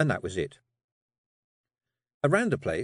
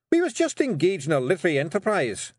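high, louder Scottish clansman voice; indignant Rob; 8 semitones higher, 4 dB louder than usual. Acted. Rob answers the men who burst in, indignant. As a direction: protest in a pitched-up Scottish voice. Rob glares, defends himself to intruders.

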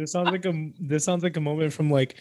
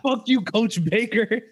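high, slow hammed-up shout; mock movie outburst; 7 semitones higher, 4 dB louder, slower than usual. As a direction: play it like a cheesy teen drama. Mocking movie scene line.

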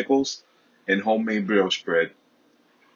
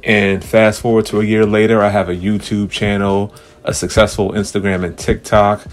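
big booming show host voice; announcer; 6 dB louder than usual. Pretend. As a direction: play the grand host welcoming fans. Grand mock podcast host intro.

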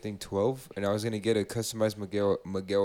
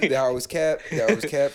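laughing; 5 semitones higher, 6 dB louder than usual. Real, not acted. Laughing denial after spam call.

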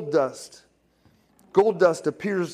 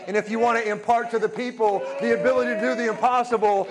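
raised-pitch preacher voicing God's declaration; impassioned preaching; 4 semitones higher than usual. God answering the preacher about the impossible, impassioned preaching. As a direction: build higher as you declare it to the congregation.

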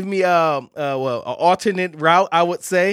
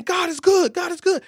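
high calling praise; 12 semitones higher than usual. Retold or imitated. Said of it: "And so I kept saying".

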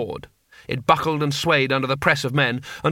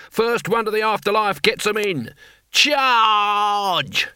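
battle cry; 7 semitones higher, slower than usual. A narrator's reading. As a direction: rouse the old men into the charge. Cohen orders the charge.

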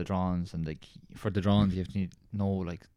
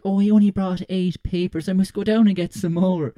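hint of a fussing teacher; mock teacher; 10 semitones higher, 6 dB louder than usual. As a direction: imitate the flustered teacher. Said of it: "she was like".

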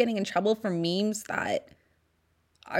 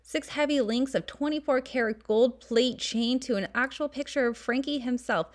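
vintage ad read; 5 semitones higher than usual. Acted. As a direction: sell it like a 1940s announcer. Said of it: "And the flyer says".

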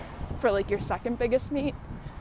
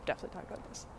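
whispered aside; 2 semitones lower, 12 dB quieter, much faster than usual. Real, not acted. Aside to self mid-story.

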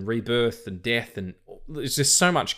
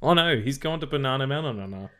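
trying not to laugh; 5 semitones higher than usual. Real, not acted. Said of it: "I'm trying to be serious".

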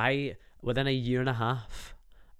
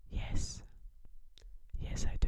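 breathy soft whisper; 8 dB quieter than usual. Shown as a demonstration. Answering the interviewer, a soft whisper. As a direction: whisper it to draw them in. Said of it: "whisper it to them".

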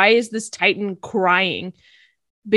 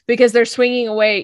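ordinary delivery

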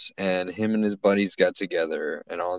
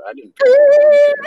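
squeaky high voice; 12 dB louder than usual. Pretend. Goofy high squeal after voice joke.

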